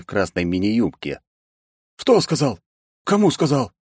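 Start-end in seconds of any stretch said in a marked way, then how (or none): 2.0-3.7 high startled confusion; shocked mumble. Acted. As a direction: caught off guard, baffled. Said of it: "пробормотал Бен, шокированно меня рассматривая".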